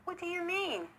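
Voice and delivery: high, puzzled question; genuinely not understand. A narrator's reading. Country Chipmunk puzzled by his guest's complaint. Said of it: "gave him a puzzled look".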